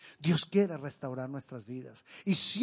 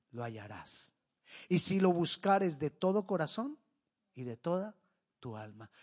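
soft scripture reading; 3 semitones lower, 4 dB quieter than usual. Acted.

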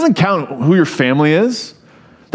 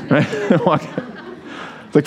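ordinary delivery

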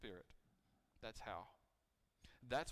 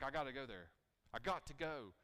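eager Paul; 3 semitones higher, 6 dB louder than usual. Retold or imitated. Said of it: "He's like".

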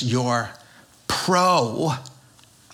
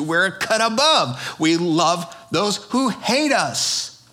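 ordinary talk

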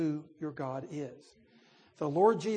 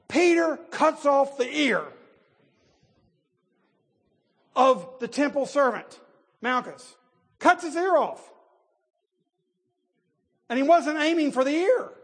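much higher and louder, near shouting; preaching at full force; 9 semitones higher, 8 dB louder than usual. Real, not acted. Preacher roaring to congregation about Peter cutting off the ear. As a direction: hammer Peter's rash blow home to the crowd.

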